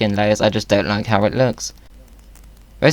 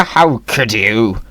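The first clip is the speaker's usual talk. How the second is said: mock outrage at celery; 8 semitones higher, 6 dB louder, slower than usual. Scolding the celery.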